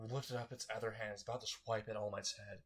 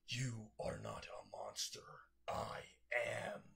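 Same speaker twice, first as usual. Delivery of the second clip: eerie monster whisper; slower than usual. Acted. Monster holding the villain's head, eerie whisper. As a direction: tell him the truth like something inhuman. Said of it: "And it's screaming".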